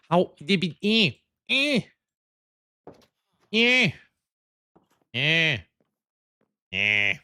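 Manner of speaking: rising frustrated grunts, climbing high then dropping; angry noises. Acted. Gamer grunting at missing wool, mock angry noises.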